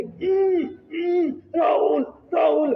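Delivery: high, choked moans, then calling the name; strangled cry. Retold or imitated. Pinned down in sleep paralysis, calling his friend, strangled and high. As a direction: imitate a voice squeezed out under a weight on the chest. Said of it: "मेरी आवाज कैसे निकली".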